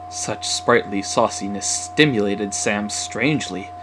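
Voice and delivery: usual speaking voice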